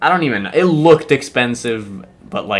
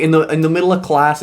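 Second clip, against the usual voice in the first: normal talking voice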